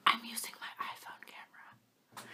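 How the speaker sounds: slow, soft confiding whisper; secret whisper. Real, not acted. Confides to viewers about her camera, soft whisper. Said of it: "I'm gonna tell you a secret".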